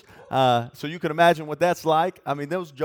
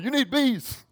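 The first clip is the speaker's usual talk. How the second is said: excited urging; 8 semitones higher, slower than usual. Retold or imitated. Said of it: "But I was telling them".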